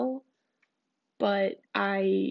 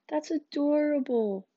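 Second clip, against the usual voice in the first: higher and slow, cooing over a cute dog; mock cooing; 6 semitones higher, slower than usual. Reading her own text about his dog, slow high coo. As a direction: drag out the adoration. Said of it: "I said".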